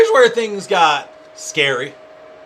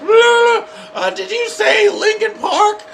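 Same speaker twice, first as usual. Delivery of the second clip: much higher, slow synthetic voice; robotic voice assistant; 4 dB louder, much slower than usual. Retold or imitated. Google Voice mishearing the drunk comic, high and robotic. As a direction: sound like a phone assistant mishearing. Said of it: "it's just".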